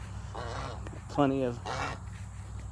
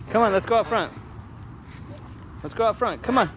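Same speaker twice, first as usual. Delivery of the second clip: coaxing call; 7 semitones higher, faster than usual. Real, not acted. Calling to the dog.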